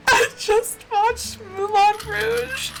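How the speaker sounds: high, slow mock-weepy wail; hammed-up tearful whine, drawn out high; retold bawling. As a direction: wail it out slowly, imitating a tearful film fan. Retells bawling over a film.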